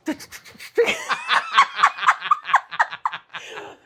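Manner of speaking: rapid rattling machine gun noise; machine gun sounds. Shown as a demonstration. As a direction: fire off a mouth machine gun. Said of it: "Okay, let me try".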